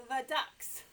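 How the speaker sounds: ordinary talk, nothing marked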